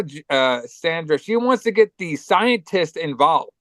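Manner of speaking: ordinary talk, nothing marked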